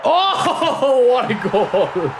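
high, loud laugh through the words, slowing down; stunned laughter at the opponent's goal; laughing in disbelief. Real, not acted. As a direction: laugh it out, amazed.

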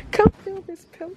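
eager high plea. Real, not acted. Urging mom to feel pillow.

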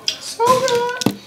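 delighted. Real, not acted. Savoring food, sing-song delight.

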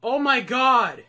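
stunned. Real, not acted. Stunned after near back-to-back.

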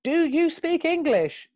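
high, slow, spelled-out question; exaggerated slow high enquiry; talking to a foreigner. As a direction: ask slowly and high, as if to a non-English speaker. Addressing injured foreign stranger.

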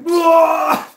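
very high, loud yelp; sudden scream as the arm is wrenched; pained scream. Retold or imitated. Patient reacting to doctor grabbing his arm, high scream. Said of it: "and I was like".

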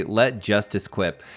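normal talking voice

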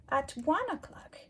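ordinary delivery

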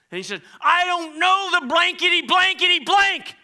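loud, high mock cursing rant. Preacher acting out Peter's cursing, loud mock rant. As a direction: rant like a sailor losing it. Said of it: "he began to, to curse and rant".

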